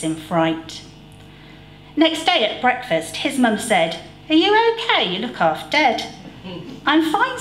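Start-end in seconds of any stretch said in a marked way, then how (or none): 4.3-6.1 mum voice. A narrator's reading. 6.9-7.4 put-on boy voice. A narrator's reading. Stan voiced answering mum.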